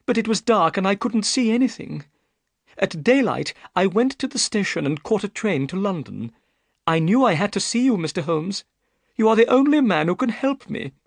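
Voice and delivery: put-on a worried young lady pleading her case; Miss Stoner.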